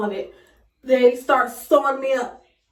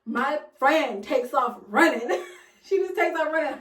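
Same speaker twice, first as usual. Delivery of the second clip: normal talking voice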